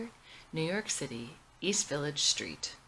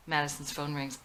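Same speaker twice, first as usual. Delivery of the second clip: plain reading voice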